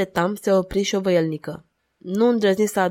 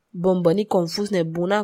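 usual speaking voice

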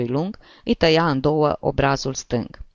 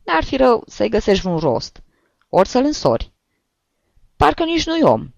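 put-on old priest teasing; 7 semitones higher than usual. A narrator's reading. Priest mocking the brother.

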